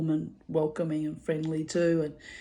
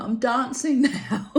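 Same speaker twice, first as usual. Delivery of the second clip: higher, laughing as she answers; laughing reply; 7 semitones higher, 5 dB louder than usual. Retold or imitated. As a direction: giggly answer.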